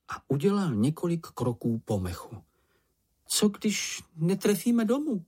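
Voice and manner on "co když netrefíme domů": ordinary delivery, nothing marked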